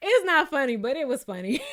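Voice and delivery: talking through a laugh; real amused laughter. Real, not acted. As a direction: laugh while admitting it.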